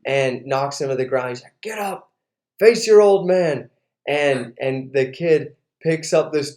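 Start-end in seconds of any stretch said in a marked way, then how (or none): 1.6-3.7 raised-pitch order to a fallen opponent; retold fatherly dare, higher than usual; challenging dad. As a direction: goad him to his feet. Dad orders knocked-down son up.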